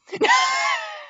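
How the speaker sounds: piercing high cackle; laughing scream; shrieking laugh. Real, not acted. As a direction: squeal with laughter. Shrieking laugh after line.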